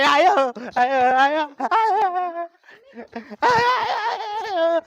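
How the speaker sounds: string of wails climbing high at the end; comic yowls, half laughing; repeated "aay" moaning. Acted. Drunk man carrying on before woman, comic high wailing.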